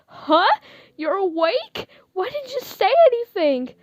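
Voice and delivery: high, loud startled disbelief; flustered surprise. Acted. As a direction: caught off guard and embarrassed. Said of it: "how flustered I am".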